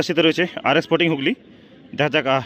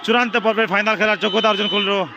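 excited winning call; 5 semitones higher than usual. Real, not acted. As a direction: shout the winner with rising joy. Winning penalty, team reaches final.

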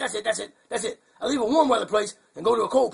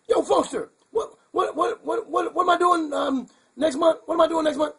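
higher, flustered calls, what what what tumbling out; excited calling out; 8 semitones higher than usual. Acted. Skeeter calls over to Funkster, high and excited. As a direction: call across to the host in a high, excited rush.

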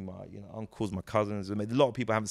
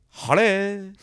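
put-on posh greeting, higher; 9 semitones higher, 6 dB louder than usual. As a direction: fake posh accent on one word.

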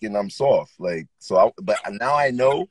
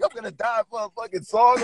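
higher, frantic retold panic; half-mock terror, voice pitched up; panicked cry; 9 semitones higher than usual. A rapper retelling being trapped in the van, mock panicked cry. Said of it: "I was like".

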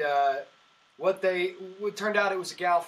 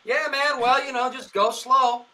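lightly voiced, higher and louder, laughing; mock knowing reply; 7 semitones higher, 6 dB louder, slower than usual. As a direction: play along with a wink. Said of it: "I was like".

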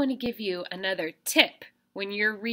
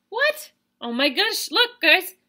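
surprised; 11 semitones higher, 5 dB louder than usual. Acted. Goat reacts to the troll.

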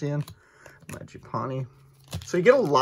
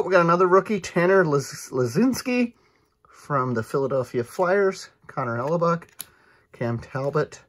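ordinary delivery

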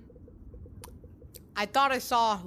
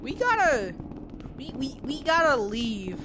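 high panicked yelling; breathless fright; 4 semitones higher than usual. Real, not acted. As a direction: urge them on in panic. Fleeing, urging teammates.

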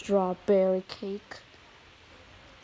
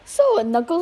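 high, loud call to Knuckles; greeting Knuckles loudly; 8 semitones higher, 10 dB louder than usual. Acted. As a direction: call his name out brightly. Calling out to Knuckles.

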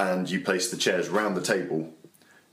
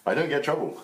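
bouncer voice; 8 semitones higher than usual. Pretend. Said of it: "This, this is my bouncer voice".

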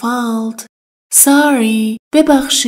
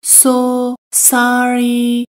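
slow drill; much slower than usual. Shown as a demonstration. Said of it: "خیلی متاسف".

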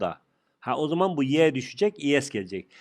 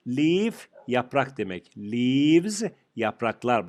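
slow teaching; much slower than usual. Shown as a demonstration. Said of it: "teacher slow model of plurals".

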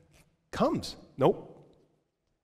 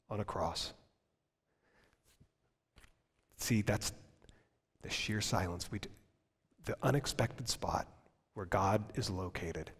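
soft; 4 semitones lower, 10 dB quieter than usual. Real, not acted. Hushed close of the point.

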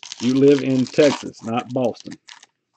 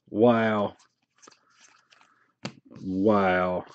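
ordinary delivery

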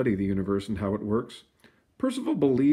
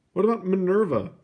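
eager suggestion; 9 semitones higher, 4 dB louder than usual. A narrator's reading. Public suggesting names.

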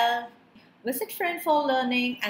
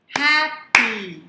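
"Happy" in two loud beats; syllable by syllable; 6 dB louder than usual. Shown as a demonstration. Teacher leading clapping practice, "Happy" split in two. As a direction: punch out "Happy" as hap, py. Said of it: "identify syllables by clapping".

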